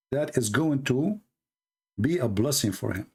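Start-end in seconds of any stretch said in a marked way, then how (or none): none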